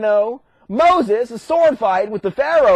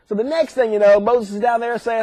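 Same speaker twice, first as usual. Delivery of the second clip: ordinary talk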